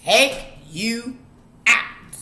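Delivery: rising to a loud threat; stern parent threat. Retold or imitated. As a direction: parent warning the kid, growing loud. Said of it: "y'all say it with me now".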